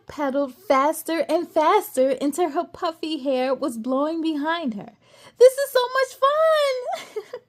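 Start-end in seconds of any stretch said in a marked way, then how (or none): 5.4-7.5 put-on high, loud child voice full of glee; giggly little girl. A narrator's reading. Aria riding fast, gleeful. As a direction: squeal it like a six-year-old on a bike. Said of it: "she giggled".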